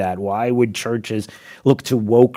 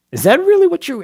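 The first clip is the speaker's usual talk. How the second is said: incredulous; 5 dB louder than usual. Real, not acted. Incredulous rhetorical challenge.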